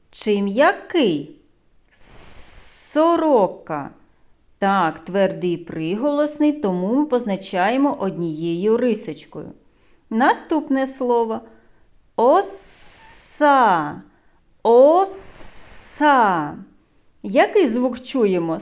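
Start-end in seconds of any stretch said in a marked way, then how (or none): 2.9-3.9 "Сорока" with a long initial "с"; drawn-out S. Shown as a demonstration. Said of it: "щоб добре було чути звуки". 12.1-16.6 "Оса" with a long "с" between the vowels; drawn-out S. Shown as a demonstration. Teacher showing the middle sound, "Оса" with long "с". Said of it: "щоб добре було чути звуки".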